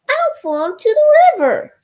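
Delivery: high, loud worried ladybug; ladybug cry. A narrator's reading. Child reading the ladybug's fear of falling in, high. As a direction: wail it up high like a scared bug. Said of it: "said the ladybug".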